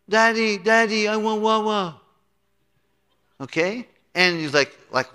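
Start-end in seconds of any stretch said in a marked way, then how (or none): 0.1-2.0 put-on higher, whiny toddler voice begging for water; little kid asking. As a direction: play a small child calling for daddy. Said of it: "kids might say".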